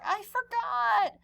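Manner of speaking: high, drawn-out sheepish excuse; high slow kid voice. Acted. Clark excusing himself to hungry friends, high and slow. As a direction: stretch it out like a guilty kid.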